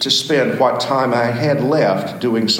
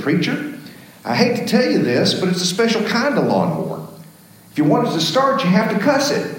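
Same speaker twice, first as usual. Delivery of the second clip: lightly voiced young man, pitched higher; a sly young fellow breaking news gently; young man's voice; 10 semitones higher, faster than usual. Young man teasing the preacher, lightly voiced higher.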